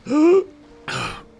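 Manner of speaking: sharp loud hiccup gasp; a sudden hiccup. Real, not acted. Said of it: "Oh, God, the hiccups".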